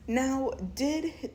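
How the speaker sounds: normal talking voice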